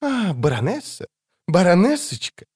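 hissing mockery. A narrator's reading. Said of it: "прошипела она".

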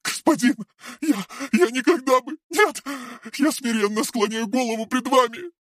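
high, grovelling and servile; fawning. A narrator's reading. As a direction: grovel before your master. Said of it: "начала лебезить Мария".

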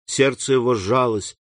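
ordinary delivery, nothing marked

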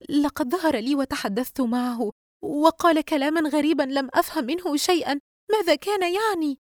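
high, nervous, rising worry; tense. A narrator's reading. Yusra tells Dina about the apparition, tense. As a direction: tell it with growing tension. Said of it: "يسرى بتوتر".

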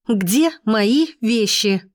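slow, word-by-word demand; spaced-out angry question; angry hiss. A narrator's reading. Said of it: "шипя спросила Полина".

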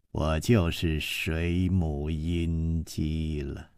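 low, slow scornful sneer; mocking disbelief. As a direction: sneer at his claim, slowly. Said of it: "冷笑起来".

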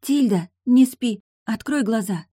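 loud, high urgent call. A narrator's reading. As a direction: shout to wake someone drifting off. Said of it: "раздавался голос Эйлин откуда-то издалека".

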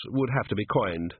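ordinary delivery

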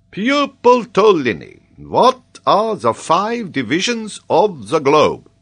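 loud, high, drawn-out command; solemn professor. A narrator's reading. Geographer begins examining the boy, pompous professor voice. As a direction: pompously summon a pupil to his feet. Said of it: "put on a solemn voice becoming to a professor".

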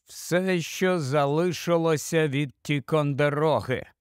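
ordinary delivery

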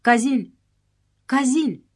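mild narrated calling of a name. A narrator's reading. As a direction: call his name over and over. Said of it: "начал звать".

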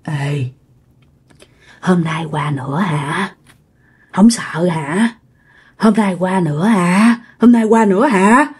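low, slow, whispery taunting questions; returning ghost voice. Retold or imitated. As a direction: creep up and ask it menacingly. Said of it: "nghe cái giọng hôm qua hỏi nó nữa".

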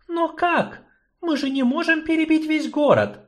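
helpless objection; sorceress, high. Acted. Sorceress objects to gnome, high despairing voice.

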